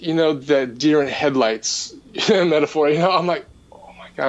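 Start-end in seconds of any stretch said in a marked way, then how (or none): 3.7-4.3 retold stunned whisper, barely voiced; awestruck whisper. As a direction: whisper it under your breath in awe. Retelling awestruck recognition of figure.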